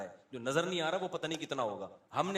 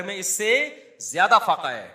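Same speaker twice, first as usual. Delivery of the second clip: louder, ringing emphasis on زیادہ فاقہ; emphatic cry; 3 semitones higher, 11 dB louder than usual. Acted. Preacher to congregation about the leader, loud emphatic cry. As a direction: proclaim it to the whole hall.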